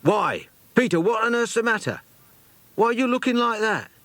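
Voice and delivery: put-on high, startled boyish exclamation; a boy alarmed by his friend's face; astonished. A narrator's reading. Jack startled by Peter's queer look, astonished. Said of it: "looked suddenly astonished".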